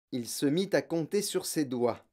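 ordinary delivery

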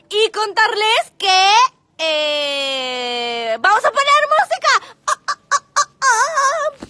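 high, loud, slow sing-song cries; excited sing-song. Acted. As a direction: burst out singing to start the music. Sudden burst into music, singing. (canturreo eufórico, agudo y fuerte)

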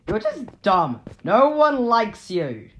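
loud, high, drawn-out jeer; scornful insult. Pretend. Player insults the stalker, scornful yell. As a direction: yell a slow, childish put-down.

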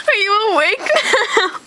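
giggling question; laughing through the words. Real, not acted. Laughing at sleepy friend.

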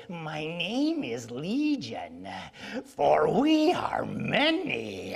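very slow, eerie demon voice; dramatic Bible demon. Retold or imitated. Preacher imitating the dramatic audio Bible's demon, slow and eerie. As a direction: stretch every word like a haunting spirit. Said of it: "you hear the thundering voice of God ... a different voice".

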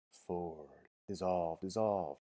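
softly fading invocation; diminishing, descending. A narrator's reading. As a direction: sink softly on each word. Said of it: "it said diminishingly on a descending scale".

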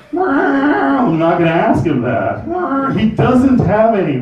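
high, very slow drawn out refusal; insistent refusal. Retold or imitated. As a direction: dig in, drawing it out. Repeated refusal louder.

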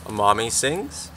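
plain reading voice

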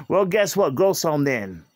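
ordinary talk

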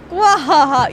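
evil maniacal laugh. Acted. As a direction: throw your head back and cackle. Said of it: "You're literally doing an evil maniacal laugh".

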